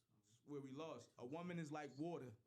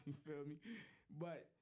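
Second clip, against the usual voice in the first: ordinary talk, nothing marked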